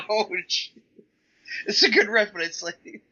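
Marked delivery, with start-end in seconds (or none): 0.1-0.7 high mock ouch; mock wince. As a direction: wince at the burn. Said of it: "I was like".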